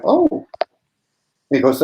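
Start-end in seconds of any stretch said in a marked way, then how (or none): 0.0-0.4 surprised oh. Retold or imitated. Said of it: "And he went".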